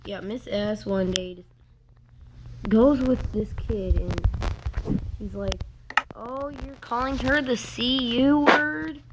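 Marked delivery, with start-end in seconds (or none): 6.2-9.0 higher, drawn-out incredulous question; mock a shocked accuser, slowly; retold accusation. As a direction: raise the pitch and stretch the question.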